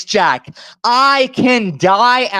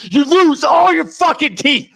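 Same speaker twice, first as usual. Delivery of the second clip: higher, raging threat; shouted threat; 6 semitones higher than usual. Real, not acted. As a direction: yell the threat straight in his face. Threat during heated quarrel.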